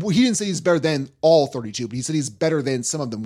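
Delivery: ordinary talk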